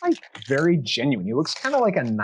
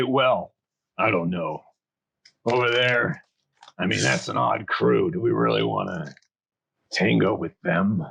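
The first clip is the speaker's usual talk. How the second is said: lower, doubtful half-orc voice; 4 semitones lower than usual. Acted. Brawny half-orc doubting the odd crew, low. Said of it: "half-orc say".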